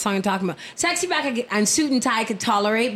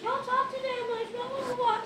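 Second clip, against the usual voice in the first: mocking blah blah; 9 dB quieter than usual. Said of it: "Jalen over here is gonna be like".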